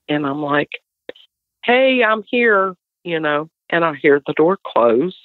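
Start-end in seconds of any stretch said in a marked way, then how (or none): 1.6-2.7 calling out. Retold or imitated. Calling out to someone entering.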